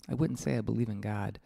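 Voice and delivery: ordinary talk, nothing marked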